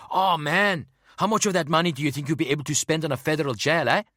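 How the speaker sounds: high, loud, fast South African rant; angry rant. A narrator's reading. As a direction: blurt it out in a panic. Angry partner ranting.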